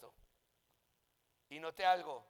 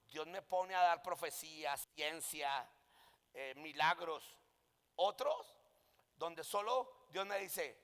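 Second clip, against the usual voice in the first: ordinary talk, nothing marked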